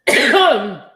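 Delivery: real loud, hard cough; choking cough. Real, not acted. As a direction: cough hard to clear a choke. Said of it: "Gosh, I'm choking".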